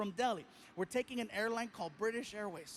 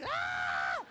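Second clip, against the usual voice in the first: loud mock shriek of laughter; hammed-up laughing cry; dying laughing; 4 dB louder than usual. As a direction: imitate someone dying laughing. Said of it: "he is dying".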